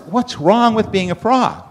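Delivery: high-pitched mock indignation; affronted frogs. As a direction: protest as an offended frog, pitched high. Frogs take offence.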